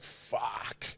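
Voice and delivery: soft unvoiced whisper; whispered curse. Driving here, whispering a curse to himself. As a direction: breathe the word out. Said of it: "I was like".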